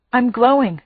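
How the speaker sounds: usual speaking voice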